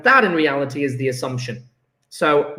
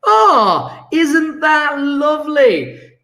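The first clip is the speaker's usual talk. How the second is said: delighted; 9 semitones higher, 4 dB louder, much slower than usual. Real, not acted. Teacher delighted by mark scheme.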